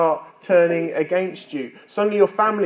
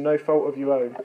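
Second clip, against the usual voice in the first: ordinary delivery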